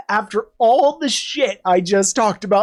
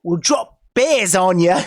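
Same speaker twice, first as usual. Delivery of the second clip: mock Aussie threat; Australian accent. Aussie space force threatening its enemies, comic Australian accent. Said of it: "My, my Australian accent".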